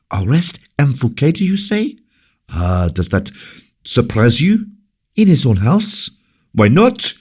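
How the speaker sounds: high, rising incredulous questions; can't believe what she hears; shocked disbelief. Acted. La Valliere to the king, high shocked disbelief.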